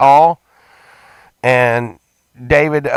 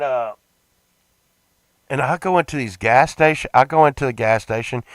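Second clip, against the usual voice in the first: usual speaking voice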